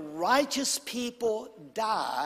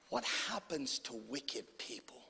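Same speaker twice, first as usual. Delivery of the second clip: whispered question; barely voiced, heavy with dread; hushed repeat of the question; 8 semitones lower, 13 dB quieter than usual.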